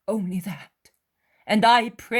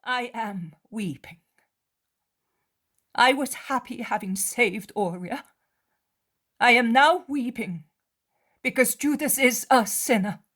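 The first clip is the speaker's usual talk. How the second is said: tearful mother; 7 semitones higher, 7 dB louder than usual. Acted. Mary weeping for Judas and urging prayer. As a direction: speak through sorrow, pleading.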